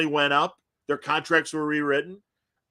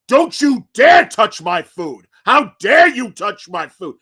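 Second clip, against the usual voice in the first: loud, slow, higher outraged demand; furious; 6 semitones higher, 12 dB louder, slower than usual. Retold or imitated. Host telling the washroom story, furious at having his ribs taken. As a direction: bellow it like your dinner was stolen. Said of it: "Jiggets' eyes just get like".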